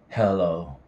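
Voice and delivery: ordinary delivery, nothing marked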